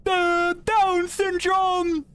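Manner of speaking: high, slow, loud laughing yell; mock stepdad shout. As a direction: bellow it out while laughing. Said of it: "started laughing, went".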